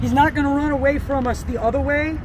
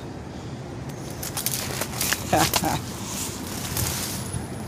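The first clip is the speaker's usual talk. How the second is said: breathy laugh; 8 semitones lower, 11 dB quieter than usual. Real, not acted. Laughing at the iguana.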